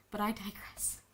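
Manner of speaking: breathy whispered aside; hushed aside to the viewers. Real, not acted. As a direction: whisper it to yourself.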